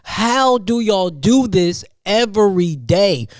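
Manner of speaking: slow, drawn-out, high disbelief; exasperated plea. Retold or imitated. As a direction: stretch it out like you're begging for answers. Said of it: "I was asking my cousin".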